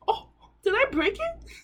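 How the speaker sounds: ordinary talk, nothing marked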